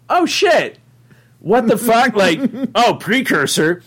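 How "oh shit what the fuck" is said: high-pitched retold astonishment; shocked realization. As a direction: relive the shock, pitch leaping up. Retells own sudden realization.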